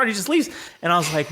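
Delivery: ordinary talk, nothing marked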